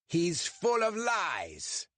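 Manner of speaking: a slow, high mock roar; German roar. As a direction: roar the accusation in a German accent, slowly. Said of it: "the German roared".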